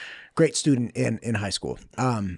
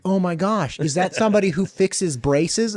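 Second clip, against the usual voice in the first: put-on higher, eager little-kid voice; 6 semitones higher than usual. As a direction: play the wide-eyed kid guessing. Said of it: "I'm like a little kid".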